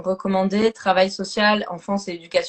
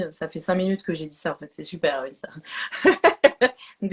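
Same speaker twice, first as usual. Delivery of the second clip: usual speaking voice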